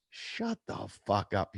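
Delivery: slow, softened disbelief; retold stunned disbelief. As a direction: draw it out, stunned by the news. Retold reaction to python news.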